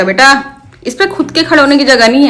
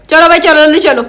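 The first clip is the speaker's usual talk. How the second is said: ordinary delivery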